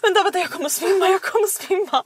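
high-pitched imitation of a friend about to faint; panicked, breathless. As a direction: plead in a mock panic, half laughing. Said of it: "och du vet, andas". (härmad andfådd panik)

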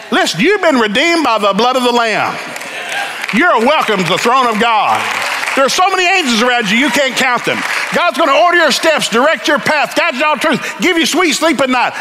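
rising revival preaching; full-force preaching. Acted. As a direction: preach it to the rafters. Preaching climax.

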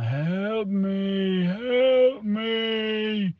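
high crying wail rising; eerie wailing plea. Retold or imitated. Dad imitating the crawling figure on the stairs, high wail. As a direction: moan it like a creature on the stairs.

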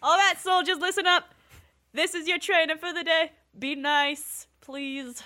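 high, loud trainer addressing recruits; drill trainer. Acted. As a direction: call the soldiers to attention in a high voice. Game master acts the recruits' trainer.

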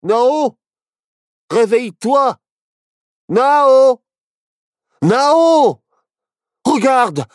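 high, urgent calling out; excited, high-pitched cries; agitated. A narrator's reading. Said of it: "s'excita Barnabé".

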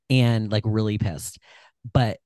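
ordinary talk

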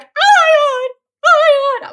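very high, loud squealing oh my God; imitated excited squeal. Studio owner on the phone to the narrator, excited high squeal. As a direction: squeal it like breathless big news.

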